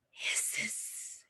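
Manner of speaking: soft whispered hiss like a snake; breathy snake hiss for the kids. Shown as a demonstration. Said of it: "Have you ever heard of a snake go".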